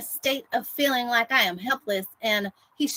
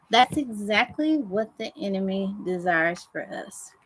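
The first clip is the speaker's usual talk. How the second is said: ordinary talk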